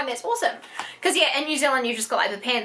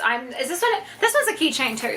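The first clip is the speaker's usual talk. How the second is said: ordinary talk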